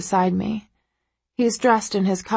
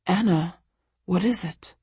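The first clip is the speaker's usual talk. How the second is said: softly worried man's voice; concerned, soft; 3 dB quieter than usual. A narrator's reading. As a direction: gently ask what's wrong. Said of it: "his voice filled with concern".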